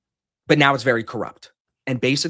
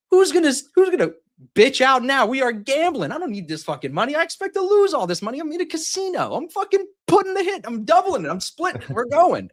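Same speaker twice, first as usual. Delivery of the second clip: pumped-up gambler; 13 semitones higher than usual. Retold or imitated. Retelling his blackjack hand to friends, shrill hyped-up gambler rant. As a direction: hype yourself up like you're all in. Retold hype at blackjack table.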